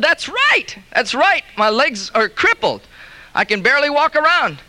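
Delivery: high, eager voice of a crippled man; crippled man eagerly agreeing with the healer; eager cripple. Acted. Crippled Joe answers the healer.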